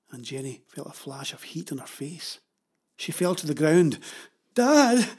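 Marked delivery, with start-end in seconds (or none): 4.5-5.1 high, loud cry for Dad; yell. A narrator's reading. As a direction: yell out for your father. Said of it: "she yelled again".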